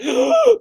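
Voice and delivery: sharp, high, loud mock gasp of the mourners; sharp mock intake of breath; imitated gasp. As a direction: gasp like a shocked crowd. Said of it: "I heard an audible gasp".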